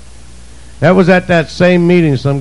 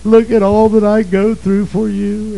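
mock whining prayer; 6 semitones higher than usual. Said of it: "I whined and I cried and I moaned".

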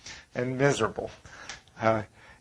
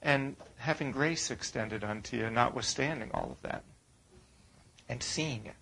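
hushed; 12 dB quieter than usual. Real, not acted. Tender turn to grace.

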